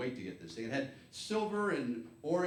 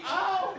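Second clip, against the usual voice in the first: much higher sharp yelp; mock yelp of pain; 5 dB louder than usual. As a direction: yelp like grandma just got hit. Grandma hit by pellet.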